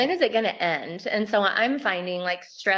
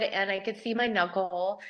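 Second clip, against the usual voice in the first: usual speaking voice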